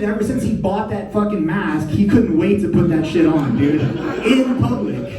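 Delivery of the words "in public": laughing. Real, not acted. Punchline said laughing.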